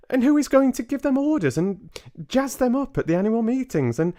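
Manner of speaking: put-on high, quick small boy's voice; a little mouse boy chattering fast; young boy mouse. Boy mouse tells grandmother of their future, high and quick.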